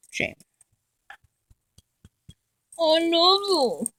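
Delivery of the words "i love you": sing-song affection for a pet; doting coo. Real, not acted. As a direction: draw it out lovingly, high. Cooing to her dog.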